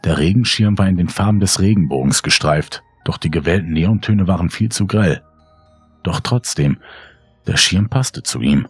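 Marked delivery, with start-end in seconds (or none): none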